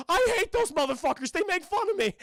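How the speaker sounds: high, loud cartoon rant; hammed-up yell of panicked grievance; ranting outburst. Courage rants about the birds.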